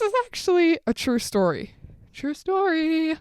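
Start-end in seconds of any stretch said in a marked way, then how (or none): none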